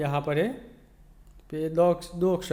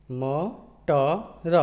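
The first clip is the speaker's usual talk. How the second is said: म, ट, र said apart with pauses; sounding out letters. Shown as a demonstration. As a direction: spell मटर letter by letter. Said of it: "यह है मटर".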